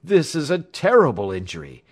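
high, loud alarm; shocked exclamation. A narrator's reading. Doctor reacting to the patient's wound, exclaiming in alarm. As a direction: exclaim over a dreadful sight. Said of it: "I cried".

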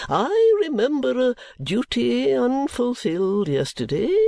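high, slow, unctuous sermonising; oily preacher voice, drawn out; pompous preacher. Acted. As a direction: play the greasy self-satisfied preacher. Chadband sermon.

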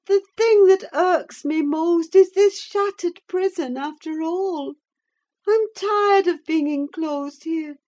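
dreamy, wistful high voice; musing. A narrator's reading. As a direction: muse aloud, longing for escape. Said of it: "added she musingly".

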